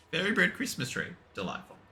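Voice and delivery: ordinary talk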